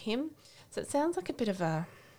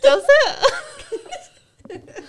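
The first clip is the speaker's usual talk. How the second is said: much higher and louder, laughing in mock surprise; laughing squeal; 15 dB louder than usual. Real, not acted.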